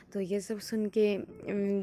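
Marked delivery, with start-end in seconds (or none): none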